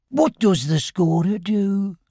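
a small timid voice, lightly done; Henry Rush the beetle. Acted. Beetle asking Christopher Robin about scoring, small and slow.

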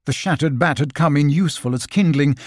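ordinary delivery

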